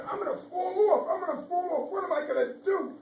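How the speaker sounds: high, loud frightened ladybug voice; high-pitched hammed-up panic; panicked squeak. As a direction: squeal it like a tiny scared bug.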